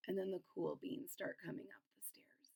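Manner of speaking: soft unvoiced narration; whisper. A narrator's reading. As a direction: hush it like a secret.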